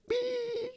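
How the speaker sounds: long, very high beep; imitated ear ringing. Telling how the wall made his ear ring, high imitated ringing. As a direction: hold a high thin tone like ringing ears. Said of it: "made my ear ring real bad".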